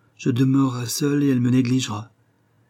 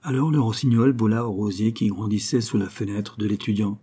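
usual speaking voice

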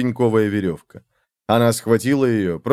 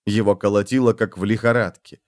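ordinary delivery, nothing marked

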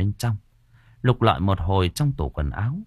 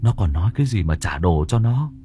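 very high, frantic panic; Tùng telling Đã about the killer doll in terror; terrified; 11 semitones higher than usual. Acted. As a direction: gasp it out like a hunted man. Friend recounts the doll ghost.

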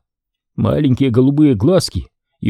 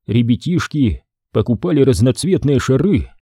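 usual speaking voice

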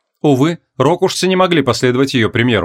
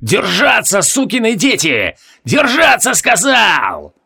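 high, roaring battle orders; shouting commander; 7 semitones higher, slower than usual. A narrator's reading. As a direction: bellow at the troops. Captain yells at soldiers.

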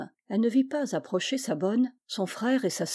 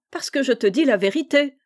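higher, louder teasing retort; laughing; 5 semitones higher, 6 dB louder than usual. A narrator's reading. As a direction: tease with amusement in the voice. (ton rieur et taquin)

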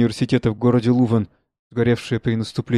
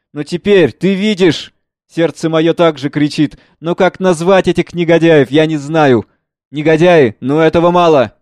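louder and higher, earnest student; passionate young son; 4 semitones higher, 8 dB louder than usual. Acted. Son answers his father, loud passionate. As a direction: pour out youthful anger.

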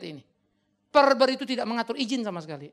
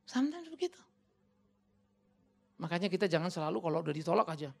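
usual speaking voice

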